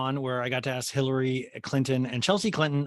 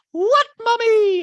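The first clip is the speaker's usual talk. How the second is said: put-on very high, slow, loud kid voice; little boy answering his mom; tiny child voice; 11 dB louder, much slower than usual. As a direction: squeak it sweetly. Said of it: "Jagger's like".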